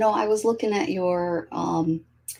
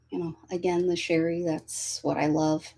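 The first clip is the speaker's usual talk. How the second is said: ordinary talk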